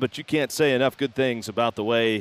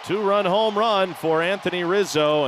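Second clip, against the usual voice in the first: excited call; 7 semitones higher than usual. Real, not acted. Home run call.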